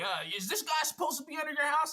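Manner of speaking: mock an officious questioner in a high voice; tattling busybody. Stranger asks the husband about the worker, imitated suspicion. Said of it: "And he was like".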